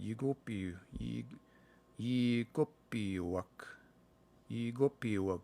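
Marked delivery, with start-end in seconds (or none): 0.0-3.5 slow sounding out. Shown as a demonstration. Learner slowly sounding out an unfamiliar Cree word. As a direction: sound out yigopiwak slowly, syllable by syllable. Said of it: "I don't know this word".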